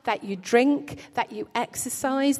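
normal talking voice

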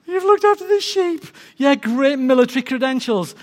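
high, loud incredulous scoff; mocking disbelief. King scoffs at a shepherd's credentials, mocking disbelief. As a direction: pitch it up in loud sarcastic disbelief. Saul scoffs at David's experience.

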